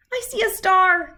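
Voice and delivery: high, loud delighted cry; excited Sister. Acted. Sister spotting the first star, crying out high and loud. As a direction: call out like you've found treasure. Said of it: "cried Sister".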